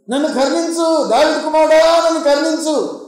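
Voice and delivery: high, pleading call of a beggar. Retold or imitated. Blind man calls to passing Lord for mercy, crying out. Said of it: "కేకలు వేస్తున్నాడు ఒక గుడ్డివాడు".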